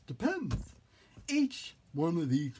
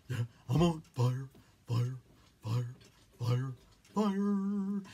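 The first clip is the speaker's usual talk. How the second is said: silly clown; 2 semitones lower than usual. Pretend. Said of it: "the clown voice comes out".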